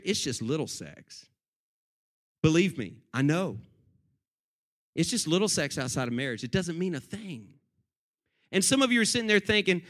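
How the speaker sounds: ordinary talk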